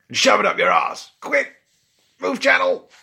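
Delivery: loud, fast yell, climbing very high; gleeful prank shout. Acted. Pranksters insulting Nero then fleeing the channel, urgent shout. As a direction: blurt the insult and scramble to hang up.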